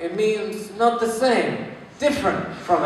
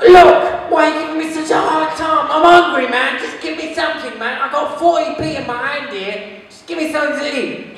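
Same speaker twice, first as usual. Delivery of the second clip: high-pitched, exasperated begging; pleading tramp; 8 semitones higher, 4 dB louder than usual. Acted. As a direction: plead hungrily as the put-upon tramp. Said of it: "And he's like".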